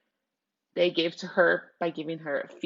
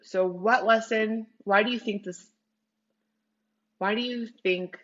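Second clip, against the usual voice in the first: usual speaking voice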